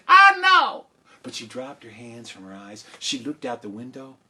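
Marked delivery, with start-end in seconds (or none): 0.1-0.9 very high, loud wail; exasperated shriek. Acted. Mother refusing to look out, high exasperated shriek. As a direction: shriek it back in despair.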